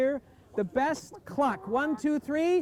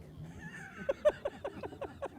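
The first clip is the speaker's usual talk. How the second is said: long, high, soft giggle; a high, trailing giggle; high giggling laugh; 8 dB quieter than usual. Real, not acted. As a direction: giggle at your own joke. Joking about taking Fern's shirt.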